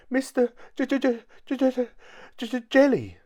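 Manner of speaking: high, squeaky stuttering on the J; frightened stammer. A narrator's reading. Mister Jelly tells the tramp his name, nervous stammer. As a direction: stutter helplessly in a tiny high voice. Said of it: "stammered Mister Jelly".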